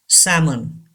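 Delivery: salmon with the L silent, "SAM-un"; salmon as "sammun". Shown as a demonstration. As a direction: say salmon with no L sound at all. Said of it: "L is silent here".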